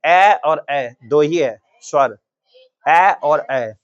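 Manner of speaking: ए और ए drawn out very slowly; slow vowel demo. Shown as a demonstration. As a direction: hold each ए long like a drill. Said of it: "कंठ तालु में क्या आएगा?". (धीरे खींचकर स्वर)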